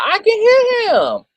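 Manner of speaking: high-pitched frustrated insisting; exasperated squeal. Real, not acted. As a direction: wail it in disbelief. Insists she hears the bishop.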